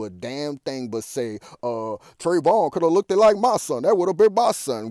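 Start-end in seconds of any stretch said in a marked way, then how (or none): 1.6-4.9 higher, louder sing-song mockery of the president; mock Obama. Mocking Obama's Trayvon statement in a high, loud singsong. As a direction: ham it up like a hollow politician.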